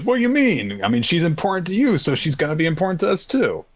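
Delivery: lightly voiced sweet friend. Voicing Kanata.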